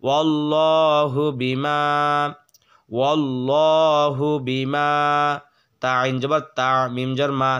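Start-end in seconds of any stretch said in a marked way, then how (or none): 0.1-4.9 slow recitation. Shown as a demonstration. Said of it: "recites the verse words slowly".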